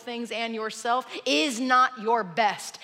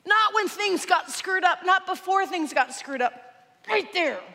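raised, higher insistent voice; screaming match; 6 semitones higher than usual. Acted. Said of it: "they begin a screaming match".

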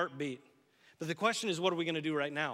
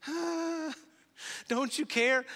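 panicked cry; 9 semitones higher, much slower than usual. Acted. Disciples shouting at the sleeping Jesus, high and frantic. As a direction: cry out in panic over the storm.

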